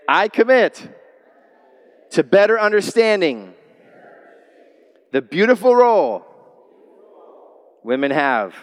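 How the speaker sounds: loud, measured call for the crowd to repeat; congregational pledge. Shown as a demonstration. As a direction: lead the room in a vow.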